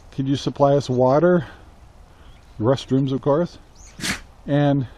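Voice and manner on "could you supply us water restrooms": ordinary talk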